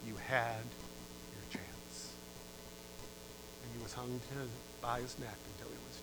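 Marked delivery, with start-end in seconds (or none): 0.0-2.1 soft, fading to a near whisper; quiet final verdict; cold dismissal. Acted. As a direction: let the last words drop away. Governor's cold final reply.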